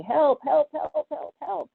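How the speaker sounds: high, urgent calls of help; retold panicked help calls; mock cries for help. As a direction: cry help like a panicked old wife. Panicked couple waving for help.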